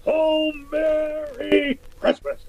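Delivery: high, slow booming ho ho ho; jolly Santa. Acted. Conklin suddenly jolly at the party, Santa-style laugh.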